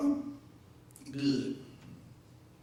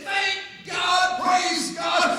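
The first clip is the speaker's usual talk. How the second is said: loud, high shout of praise; excited praise; 8 semitones higher, 7 dB louder, much slower than usual. Retold or imitated. Pastor shouting praise back at Brenda, excited and loud. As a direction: whoop it out, arms raised. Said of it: "I got as excited as she did".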